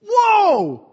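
loud, high, startled whoa; shocked whoa. Real, not acted. Reacting to online death threats, a loud shocked whoa. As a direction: recoil at the cruelty with a loud whoa.

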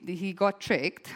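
usual speaking voice

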